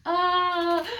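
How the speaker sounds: high, loud pained cry; anguished cry. Retold or imitated. Anime fan hit by a sad scene, crying out. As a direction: yelp like the show just stabbed you. Anime hurting her feelings.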